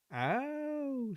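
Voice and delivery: a high, wide-eyed innocent oh. Acted. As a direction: play the innocent. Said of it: "said Toby innocently".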